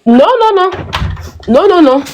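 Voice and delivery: high, quick no no no; protesting denial. Real, not acted. Denies wearing makeup, protesting. (elle nie vivement, voix aiguë)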